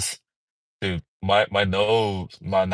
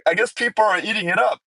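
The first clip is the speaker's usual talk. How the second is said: ordinary delivery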